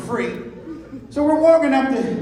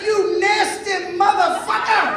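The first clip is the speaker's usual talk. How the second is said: high, drawn-out outraged shout; hammed-up fury, stretching each word out; furious cussing; 9 semitones higher than usual. Comedian cussing out the nose picker, mock furious. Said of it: "So now I'm furious".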